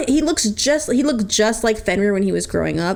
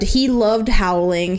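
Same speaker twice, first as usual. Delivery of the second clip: normal talking voice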